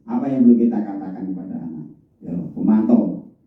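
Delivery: ordinary talk, nothing marked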